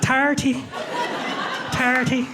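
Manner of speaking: high, lilting Irish accent. Shown as a demonstration. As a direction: say it like a cheerful Irishman. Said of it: "my Irish accent".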